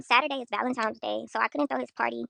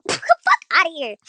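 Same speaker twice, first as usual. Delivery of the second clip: laughing dismissal; 5 semitones higher, 9 dB louder, slower than usual. Real, not acted. Laughs at herself.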